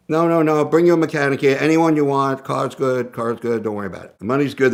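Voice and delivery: pushy dealer. Retold or imitated. Dealers pushing their mechanic offer.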